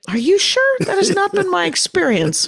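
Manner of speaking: high, wide-eyed fake innocence; mock innocent question. Kid asking stepmother, high, mock innocent. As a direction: play dumb in a squeaky voice. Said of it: "And I said".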